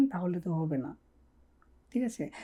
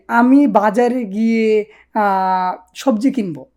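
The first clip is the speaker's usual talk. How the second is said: slow, careful prompt sentence; 6 dB louder, slower than usual. Shown as a demonstration. Teacher slowly sets the sentence for students to translate. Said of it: "আপনি বলেন যে".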